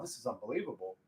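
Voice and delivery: ordinary talk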